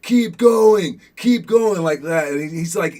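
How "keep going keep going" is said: high, loud urging yell, again and again; coach screaming. Retold or imitated. Coach yelling at the exhausted player to keep crawling, loud high urging. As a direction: push him on like a coach yelling from the sideline. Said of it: "the coach just keeps screaming".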